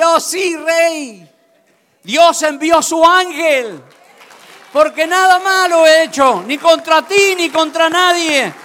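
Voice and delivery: high, loud, jubilant reply; joyful Daniel. A narrator's reading. Daniel answering the king from the lions' den, loud and joyful. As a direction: shout the good news up from the pit. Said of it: "Daniel contento de adentro dice".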